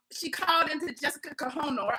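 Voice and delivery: normal talking voice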